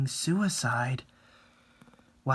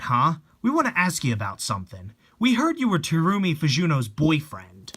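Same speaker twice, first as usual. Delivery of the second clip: ordinary talk, nothing marked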